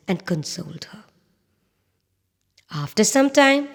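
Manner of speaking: ordinary delivery, nothing marked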